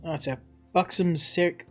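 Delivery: ordinary talk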